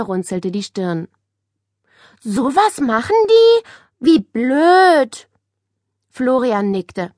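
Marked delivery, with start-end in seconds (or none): 2.2-5.3 indignant. A narrator's reading. Nele appalled at the boys' bullying.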